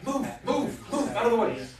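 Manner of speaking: high, loud urgent shout; shouting move aside through a crowd. Acted. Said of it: "a female voice in Elven, in, uh, Elvish shouting".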